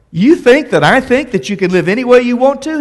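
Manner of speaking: Paul's retort. Retold or imitated. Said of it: "And Paul says".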